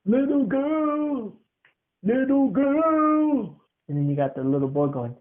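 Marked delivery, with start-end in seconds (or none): 0.0-3.6 slow drawn-out calls, higher; searching a house for a lost child; long high calls; calling out. Acted.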